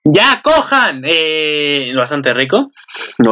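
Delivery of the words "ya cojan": loud, high outburst; excited shout. Real, not acted. Bursting out loud at the image on screen. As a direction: blurt it out at the top of your voice.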